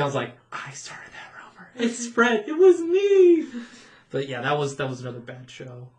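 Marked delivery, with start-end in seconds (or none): 0.5-2.5 whispered secret thought; inner whisper. Retold or imitated. Employee's private thought at meeting, whispered. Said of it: "but really deep down". 2.5-3.5 much higher, louder gleeful admission; proud inner squeal. Retold or imitated. Employee's private thought at meeting, gleeful squeal. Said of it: "but really deep down".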